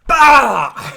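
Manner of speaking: sudden high, loud yell; imitated battle cry. Streamer shows how he just goes, imitated battle cry. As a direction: burst out with a big mimicked roar. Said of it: "He just goes like this".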